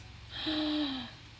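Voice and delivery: sharp intake of breath in awe; real gasp of wonder at the present; delighted gasp. Real, not acted. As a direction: gasp as the gift appears. Unwrapping a gift.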